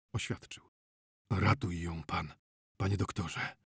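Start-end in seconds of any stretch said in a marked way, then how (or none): 1.3-3.6 husky, subdued entreaty; hoarse voice. A narrator's reading. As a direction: beg hoarsely, almost without voice. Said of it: "ochrypłym głosem odezwał się znachor".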